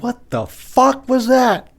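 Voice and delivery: high, slow, loud disbelief; shocked kid yell. Retold or imitated. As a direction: drawl it out like a stunned kid. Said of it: "I turned to my mom and dad and said".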